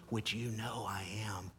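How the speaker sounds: hushed knowing aside; a whispered aside to the listeners. Real, not acted. Aside to the congregation.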